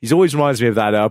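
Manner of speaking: ordinary talk, nothing marked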